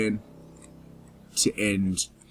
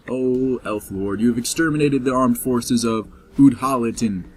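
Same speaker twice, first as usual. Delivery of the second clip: ordinary delivery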